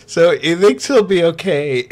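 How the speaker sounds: words on the edge of giggles; barely holding in laughter. Real, not acted. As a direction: fight back a real laugh. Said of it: "can't even get through this without laughing".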